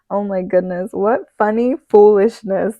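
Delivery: loud amused reaction; amused exclamation. Real, not acted. Amused by card sentence.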